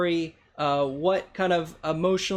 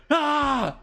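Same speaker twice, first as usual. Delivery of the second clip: monster roar; 8 semitones higher than usual. Acted. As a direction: let out an angry yell. Monster gets more mad.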